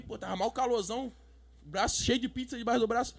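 usual speaking voice

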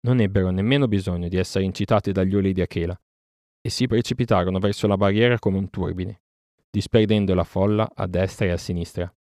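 ordinary delivery

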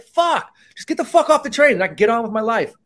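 high, mock exasperated yell; imitated irritated outburst; fed-up snap. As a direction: bark it like a tired cop at the end of a shift. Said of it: "you kinda wanna be like".

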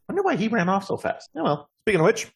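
high, perky little gnome voice; small gnome hostess chatting away; chatty gnome. Acted. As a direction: muse aloud in a high-pitched voice. Plays gnome Sarah.

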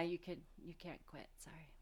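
breathy whispered aside. Real, not acted. Joking aside to her co-host, whispered. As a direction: drop to a whisper.

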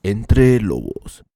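slow, drawn-out sultry show-name read; seductive voice. Shown as a demonstration. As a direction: stretch out the podcast name seductively. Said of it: "voz seductora". (voz seductora y muy lenta)

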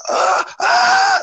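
imitated high-pitched scream; banshee scream. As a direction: let out two mock banshee screams. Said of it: "starts screaming, like, like banshee yelling".

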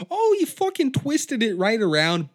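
high, loud acted outburst; hammed-up yelled reply; mock exclamation. Acted reply to injured fighter.

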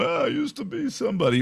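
high, wistful mock lament; washed-up barfly. Joking about a fired anchor, mock lament of a has-been. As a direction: play a sad has-been at the bar. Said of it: "on a corner bar stool going".

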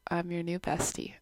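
normal talking voice

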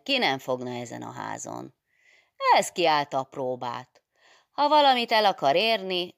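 hearty, loud teasing priest; jovial Father Szelényi. Acted. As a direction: tease them kindly. Jovial old priest teases the nuns.

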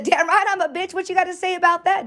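high-pitched, challenging retort in retelling; defiant comeback. Retold or imitated. Woman retelling her comeback to friend's boyfriend, defiant and high-pitched. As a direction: re-enact throwing the insult right back, pitched high.

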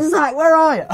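high, loud shouted question with a friend's name; calling out. Retold or imitated. Searching for Zach in the dark road, shouting for him. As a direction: yell to find your lost friend.

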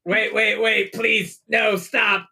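high, hammed-up begging; mock pleading. Player begs attacking spiders to stop, mock pleading. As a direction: plead in playful panic.

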